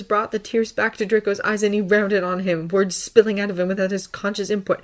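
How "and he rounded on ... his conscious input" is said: ordinary delivery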